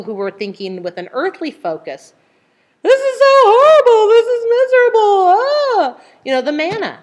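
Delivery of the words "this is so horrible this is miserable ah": high, loud wailing complaint; grumbly misery. Acted. Earthly minded Israelites bewailing their lot in the wilderness, miserable wail. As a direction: moan it loudly, pitched way up. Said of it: "they're grumbly and miserable".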